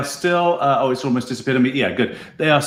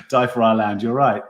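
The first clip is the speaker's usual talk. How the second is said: ordinary talk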